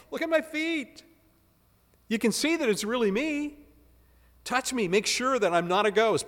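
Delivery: voicing Jesus. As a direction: plead with them to touch and see.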